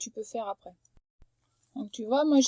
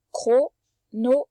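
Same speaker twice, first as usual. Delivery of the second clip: chronomètre drawn out slowly, syllable by syllable; slow sounding out; 7 dB louder, much slower than usual. Shown as a demonstration. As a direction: stretch chronomètre for the child. (chronomètre, lentement, syllabe par syllabe)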